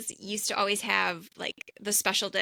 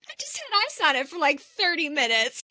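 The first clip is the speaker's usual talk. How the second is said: higher, rushed then slowed whine; mock indignant protest; complaining brother; 7 semitones higher, 3 dB louder than usual. Brother explaining the ice to his twin, mock whiny protest. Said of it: "he goes".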